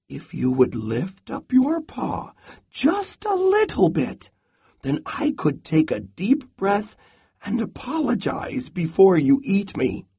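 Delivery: high small squirrel voice; pleading squirrel. A narrator's reading. Pinned squirrel humours the hungry Bear, pleading.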